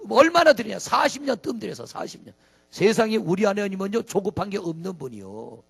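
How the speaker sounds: ordinary delivery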